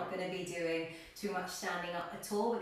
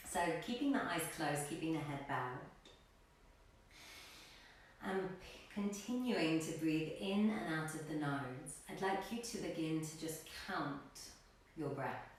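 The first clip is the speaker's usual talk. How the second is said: calm yoga teacher cueing breaths, long pauses; guided meditation; 2 semitones lower, 4 dB quieter than usual. Real, not acted. Yoga teacher settling class into a seated position, guided meditation. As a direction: settle them gently into stillness, phrase by phrase.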